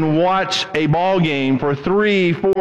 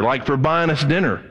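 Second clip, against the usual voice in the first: ordinary talk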